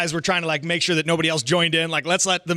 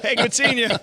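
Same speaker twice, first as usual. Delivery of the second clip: mock friendly greeting; 7 semitones higher than usual. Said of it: "that's what it sounds like".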